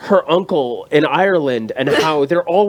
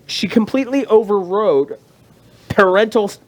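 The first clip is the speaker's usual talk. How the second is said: ordinary talk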